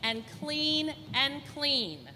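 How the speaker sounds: put-on bright, clear storybook reading for little kids; lively picture-book read-aloud; reading to children. Librarian reads picture book aloud.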